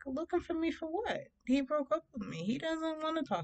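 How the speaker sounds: high-pitched retold incredulity; indignant disbelief. Retorting to friends after breakup.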